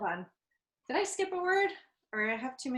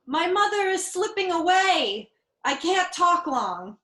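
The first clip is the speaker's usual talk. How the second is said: higher, louder, slow mock despair; mock dramatic grief; 7 semitones higher, 6 dB louder than usual. Daughter calling her fiancé from her mother's deathbed, hammed-up grief. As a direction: ham up the grief, slowly. Acted dramatic phone line.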